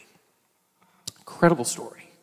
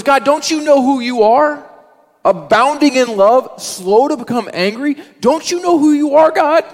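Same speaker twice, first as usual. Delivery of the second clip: much higher, louder impassioned appeal; pleading Moses; 12 semitones higher, 6 dB louder than usual. Retold or imitated. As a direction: remind God who he is, urgently. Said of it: "And Moses goes".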